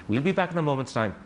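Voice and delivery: ordinary talk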